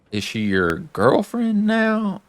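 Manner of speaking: high, slow teasing lilt; sing-song fatherly ribbing; teasing Joel. Retold or imitated. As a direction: drag out the question to tease her. Joel teasing Ellie about Dina.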